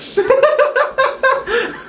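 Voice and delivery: very high rising laugh; squealing laugh. Real, not acted. Laughing at no super kicks.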